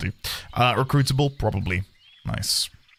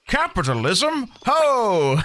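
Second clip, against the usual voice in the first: npc voice; 7 semitones higher, much slower than usual. Acted. Voicing a game NPC reaction.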